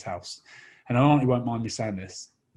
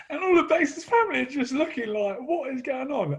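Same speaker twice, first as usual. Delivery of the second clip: laughing; 13 semitones higher than usual. Real, not acted. Family staring, teller laughing.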